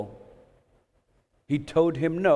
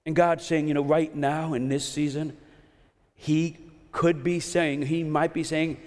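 ordinary talk, nothing marked

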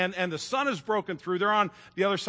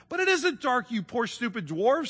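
high-pitched exasperated protest; acted frustrated girl in a story; exasperated pleading; 6 semitones higher than usual. A narrator's reading. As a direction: plead with stubborn listeners. Lucy pleads with blind dwarves.

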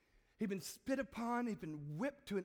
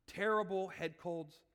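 ordinary talk

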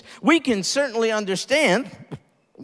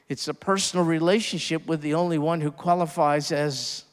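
usual speaking voice

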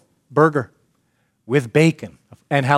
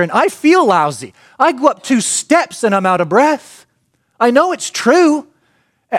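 high, loud, pleading whine that slows and stretches at the end; whiny believer; 9 semitones higher, 5 dB louder than usual. Retold or imitated. Preacher imitates a churchgoer protesting his belief, high pleading whine. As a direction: whine it like a man begging to be believed. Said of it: "What's the question?".

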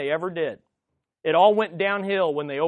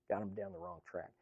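softer, quicker repeat under the breath; quiet fast echo; 10 semitones lower, 20 dB quieter, faster than usual. Shown as a demonstration. Preacher repeats the warning to the congregation, quiet and fast. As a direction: drop it low and toss it off quickly. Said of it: "And then that got 'em down the wrong track".

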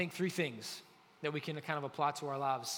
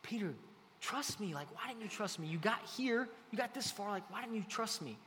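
softer, higher gentle plea; Jesus softly reassuring Peter after he sank; 4 semitones higher, 4 dB quieter than usual. Retold or imitated. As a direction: tenderly coax a friend who doubted. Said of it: "It's like this".

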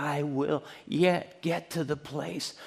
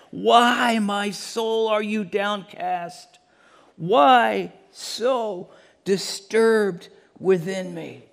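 slow, louder, pleading lament; anguished cry; 7 semitones higher, 4 dB louder, much slower than usual. Shown as a demonstration. Preacher voicing the psalmist's anguish for the congregation, slow and pleading. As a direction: cry it out so they feel the ache. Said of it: "Can you feel it? Can you hear it?".

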